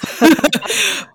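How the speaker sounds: a real burst of laughter, high and loud; hearty laugh. Real, not acted. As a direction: laugh out loud at your own joke. Laughs about driving really fast.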